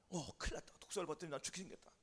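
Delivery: very soft, hurried inner voice; hushed alarm. Acted. Pigeon realizing the viper will kill it, hushed alarm. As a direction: think aloud under your breath in danger. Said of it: "비둘기는 생각을 합니다".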